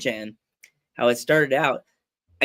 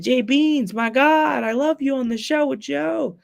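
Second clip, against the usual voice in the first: high, louder starstruck delivery; gushing fan; 9 semitones higher, 4 dB louder, slower than usual. Retold or imitated. Fan greeting Justin at work, gushing. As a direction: gush at your favorite show host.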